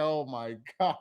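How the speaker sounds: very soft disbelieving oh my God; hushed stunned aside; stunned whisper. Retold or imitated. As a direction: breathe it out in disbelief. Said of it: "I was like".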